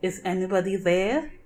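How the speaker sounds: ordinary delivery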